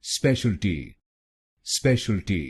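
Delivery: स्पेशलिटी as spesh-al-ti, three syllables; correct pronunciation. Shown as a demonstration. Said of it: "तो बनेगा". (सही उच्चारण, स्पेशलिटी तीन शब्दांशों में)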